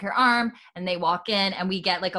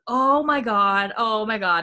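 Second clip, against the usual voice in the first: mock disgusted cry; 3 semitones higher, 5 dB louder, slower than usual. Everyone reacts to skunk smell.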